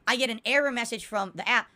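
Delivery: ordinary talk, nothing marked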